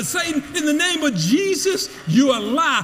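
firm commanding rebuke; rebuking Satan again. Shown as a demonstration. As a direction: cast him out with authority. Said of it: "you need to say".

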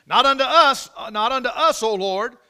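loud, high preaching climax; preaching at full force. Real, not acted. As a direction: thunder the verse at the congregation.

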